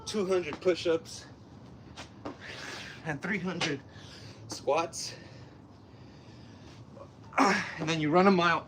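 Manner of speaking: short phrases pushed out between reps; real exertion, puffing between phrases; out of breath. Real, not acted. Talking while doing burpees.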